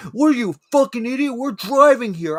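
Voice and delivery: imitating a cop screaming at a driver; retold screaming cop. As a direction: bark it like a furious cop. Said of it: "he started literally screaming at me".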